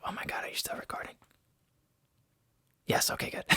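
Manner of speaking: startled hushed whisper. Real, not acted. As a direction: whisper in a sudden panic. Computer shut off mid-recording.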